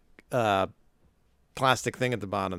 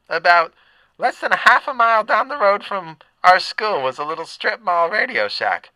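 raised, loud lunch lady voice; gruff lunch lady; 6 semitones higher, 6 dB louder than usual. Acted. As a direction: bark it like a school lunch lady. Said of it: "the mom sounded like the lunch lady".

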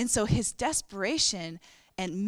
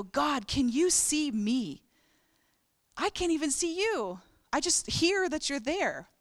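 pleading; 5 semitones higher, slower than usual. Retold or imitated. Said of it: "In this crowd of all these people".

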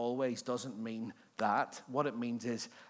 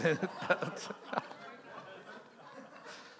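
long breathy laugh; 7 semitones higher than usual. Real, not acted. Laughing with congregation.

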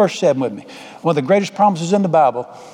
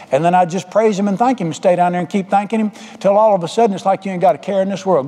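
ordinary delivery

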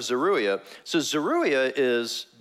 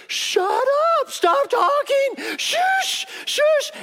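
frantic shushing; 5 dB louder, much slower than usual. Acted. Companion silencing a talker among sleeping soldiers, frantic shushing. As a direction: desperately cut the chatterer off, high and loud.

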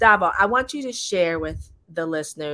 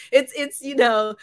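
laughing; 8 semitones higher, 7 dB louder than usual. Real, not acted. Host laughing while introducing guest.

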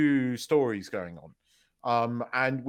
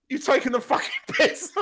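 high, loud retold indignation; comic affronted outburst; mock outrage; 12 semitones higher, 6 dB louder than usual. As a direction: protest loudly, on the edge of laughter. Retells mock outrage at rival football badge.

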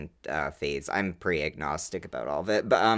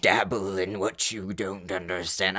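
slow, solemn mock-preachy warning. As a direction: intone it like a stern elder. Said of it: "I'm not trying to sound like that".

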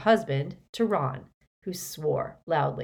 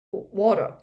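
ordinary delivery

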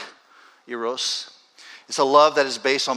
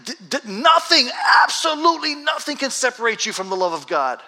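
high, loud outburst of insistence; impassioned shout, as if swept away mid-letter; urgent, carried away; 11 semitones higher, 5 dB louder than usual. Acted. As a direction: burst out urgently, stumbling into the key word. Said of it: "he just gets carried away".

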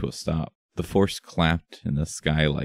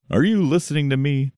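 indignant shout; 10 semitones higher, 4 dB louder than usual. Acted. Gold Star confronting Wrath.

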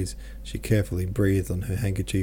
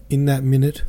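usual speaking voice

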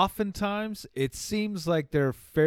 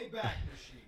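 whispered aside; 7 semitones higher, 16 dB quieter, much faster than usual. Pretend.

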